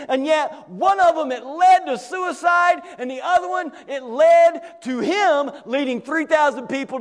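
high, driving pulpit delivery; impassioned, emphatic sermon voice; full-force preaching. Real, not acted. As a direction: preach it hard, pitch held up.

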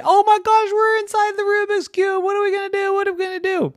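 very high, squealed panic; frantic children discovering they're trapped; panicked kids. Acted. Kids panic trapped inside cube.

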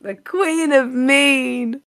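drawn-out regal announcement; slow grand title. Pretend. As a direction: savour each word like a crown. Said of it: "host repeats the title slowly".

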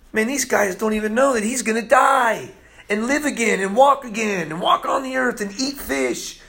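high-pitched, building amazement; excited preaching. Real, not acted. Preacher telling the congregation of the resurrection, truly excited. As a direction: let real excitement push the pitch right up. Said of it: "Oh, I'm so excited".

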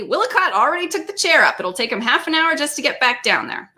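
high-pitched, breezy explanation; cheerful. A narrator's reading. Fred explains Willicott took the chair up, cheerfully. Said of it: "Fred said cheerfully".

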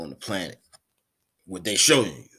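normal talking voice

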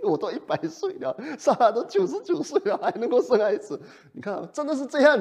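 mocking disbelief. Preacher voicing Abraham laughing at God's promise. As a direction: scoff at the idea of a baby.

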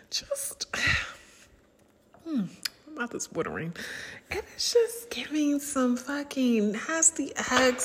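hushed, turned-on whisper; sultry whisper. Real, not acted. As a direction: whisper it like a secret craving. Whispering about desire.

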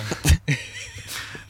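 high-pitched burst of laughter. Real, not acted. Guest answering the favourite-drug question, high laugh. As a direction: laugh out high, well above the speaking voice.

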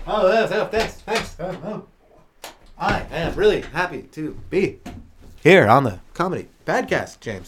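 slow, halting Shatner voice; Shatner impression. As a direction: halt between every phrase. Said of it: "Here he is".

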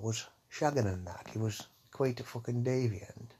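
usual speaking voice

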